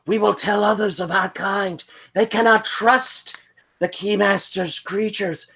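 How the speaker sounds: high, airy spirit voice; wind creature voice. Acted. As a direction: speak as an otherworldly wind being.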